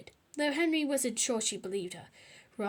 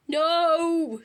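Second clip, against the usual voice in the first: loud, higher shriek of protest; Henry shrieking at bedtime; 6 semitones higher, 11 dB louder than usual. A narrator's reading. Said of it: "shrieked Horrid Henry".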